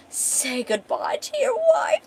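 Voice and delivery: very high, slow maniacal villain voice; Cicero. Acted. Villain about to slit the wife's throat, manic taunt. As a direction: draw out the taunt with mad glee. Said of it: "Cicero, yeah".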